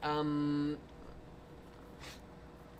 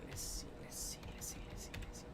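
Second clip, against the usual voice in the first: whisper; 16 dB quieter than usual. Real, not acted. Muttering to self while searching.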